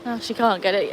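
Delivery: ordinary talk, nothing marked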